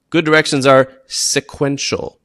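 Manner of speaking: ordinary talk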